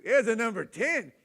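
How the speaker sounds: "number ten" hit high and loud; emphatic exclaim. Shown as a demonstration. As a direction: exclaim the horror of the diaper. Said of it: "It wasn't a number two".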